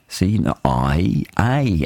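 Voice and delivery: slow, deliberate vowel demo of I and A; sounding out vowels. Shown as a demonstration. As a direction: sound each vowel out slowly like a teacher. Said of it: "whether it's an I or an A".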